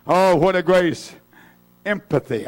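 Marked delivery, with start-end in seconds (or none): none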